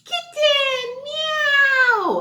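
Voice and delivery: high, cute meowing; playful kitten meows. Acted. Teacher imitating a kitten for the kids, high meows. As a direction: mew like a tiny kitten.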